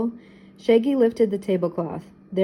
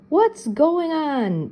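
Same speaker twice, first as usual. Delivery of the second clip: much higher, slow alarmed yell; mayor yelling in the blackout; 8 semitones higher, much slower than usual. A narrator's reading. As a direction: call out into the dark. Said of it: "Mayor Redding yelled".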